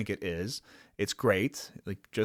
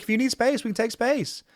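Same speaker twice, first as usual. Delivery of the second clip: mock sweet voice; 13 semitones higher, 5 dB louder than usual. [name] sounding normal, sweetly.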